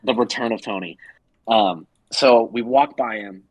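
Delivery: ordinary talk, nothing marked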